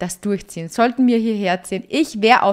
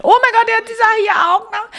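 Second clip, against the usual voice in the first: very high, loud outburst; real high-pitched fangirl panic; excited squeal; 12 semitones higher, 5 dB louder than usual. Real, not acted. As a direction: squeal it in giddy surprise. Startled as the son turns up.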